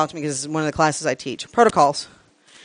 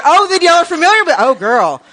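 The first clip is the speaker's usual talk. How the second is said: much louder, higher, bursting with delight; thrilled the room knows the joke; loud, gleeful recognition; excited outburst; 10 semitones higher, 8 dB louder than usual. Real, not acted. Delighted that audience knows the custom.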